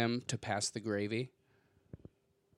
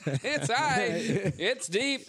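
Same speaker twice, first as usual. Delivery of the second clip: home run call; 8 semitones higher than usual. Pretend. Home run call, sports announcer.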